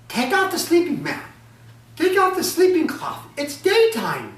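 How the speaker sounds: high, loud order; commanding wife. Acted. Ogress wife orders husband to uncover, high and loud. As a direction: bark it at him from above.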